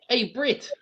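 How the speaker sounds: high-pitched mock Kiwi accent; New Zealand accent. Calling a bandmate, high New Zealand accent. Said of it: "you are doing Flight of the Conchords".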